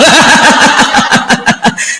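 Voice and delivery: breathy laugh. Real, not acted.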